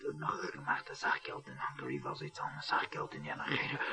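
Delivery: hushed secret whisper. Acted. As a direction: tell it as a secret, breathless and low. Secret confession aside. (geflüstert wie ein Geheimnis)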